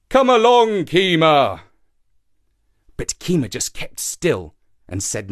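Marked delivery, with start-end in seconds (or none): 0.1-1.6 high, loud call falling on the name. A narrator's reading. Shark calls waiting monkey to come along, calling out. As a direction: holler up into a tree. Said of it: "the shark called".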